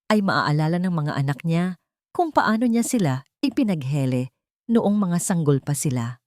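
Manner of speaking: ordinary delivery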